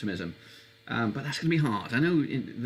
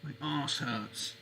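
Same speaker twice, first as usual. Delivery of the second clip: slow drawl; 7 dB quieter, much slower than usual. Shown as a demonstration. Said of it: "repeated slower and softer".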